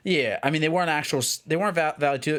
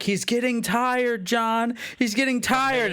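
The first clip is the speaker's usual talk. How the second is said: coach calling out; 8 semitones higher, slower than usual. Retold or imitated. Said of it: "they're like".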